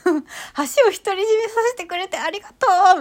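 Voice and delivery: high and loud thankful call; grateful shout. Retold or imitated. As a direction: call out your thanks across the empty bridge. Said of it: "叫んじゃうの". (感謝を大声で叫ぶ)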